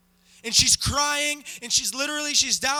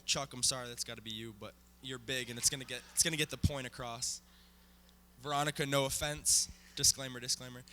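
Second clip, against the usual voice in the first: off-mic aside; 12 semitones lower, 10 dB quieter, faster than usual. Real, not acted. Aside to actors, away from mic.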